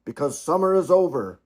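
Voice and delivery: put-on the cob speaking firmly to his children; father swan. A narrator's reading.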